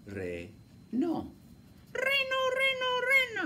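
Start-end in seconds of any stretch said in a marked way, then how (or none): 1.9-3.5 very high, slow sing-song puppet. Acted. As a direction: chirp the word like a little puppet. Puppet reads the word. (voz aguda de títere)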